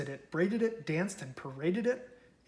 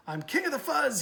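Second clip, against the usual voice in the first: higher, snarling boast; snarled; 10 semitones higher than usual. A narrator's reading. Big Bark boasting to the prairie dogs, snarling higher. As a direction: snarl it like a bully. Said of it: "he snarled".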